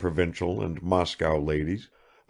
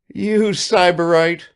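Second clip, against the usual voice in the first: high, loud, slow teasing cry; laughing cry; 12 semitones higher, 7 dB louder, slower than usual. A narrator's reading. Evdoksya teases Sitnikov, a loud laughing cry. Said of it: "cried Evdoksya with a laugh".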